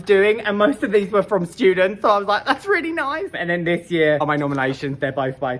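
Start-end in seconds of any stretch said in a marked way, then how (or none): 2.4-3.3 touched. Retold or imitated. Reacting to student nominations, touched and high.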